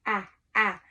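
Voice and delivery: ah said twice; short a sound. Shown as a demonstration. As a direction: model the ah vowel. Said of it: "the vowel changed to ah".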